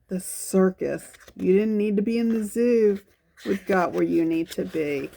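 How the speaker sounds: ordinary delivery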